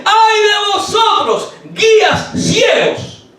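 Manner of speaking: high, slow cry of woe; slow drawn-out high woe. Acted. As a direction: call out the blind guides with righteous anger. Jesus rebukes blind guides.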